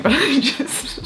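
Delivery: words breaking into laughter; giggling. Real, not acted. Reader cracking up at a goblin confession, giggling. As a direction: lose it laughing, voice rising high.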